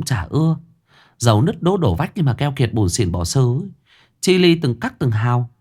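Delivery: put-on ex-girlfriend gossiping to him about the dead man; gossipy village girl. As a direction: dish the rumour like a village gossip. Ex-girlfriend badmouths the rich man.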